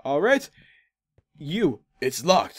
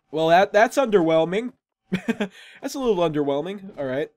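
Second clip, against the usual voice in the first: usual speaking voice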